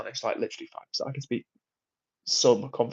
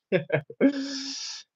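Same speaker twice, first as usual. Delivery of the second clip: real laugh, high and bright; high burst of laughter; 12 semitones higher than usual. Real, not acted. Streamer laughing at his own Geordie impression of Craig. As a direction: crack up at your own Geordie bit.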